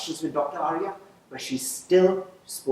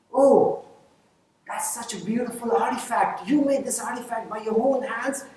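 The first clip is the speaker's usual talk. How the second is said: much higher, amazed praise; delighted admiration; 9 semitones higher than usual. Retold or imitated. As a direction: marvel at a child's handiwork. Principal admires Sonia's craft.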